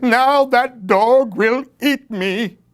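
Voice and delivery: high, slow, scared skeleton voice; frightened skeleton. A narrator's reading. Skeleton under the bed dreads the dog, high and scared. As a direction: fear of being eaten.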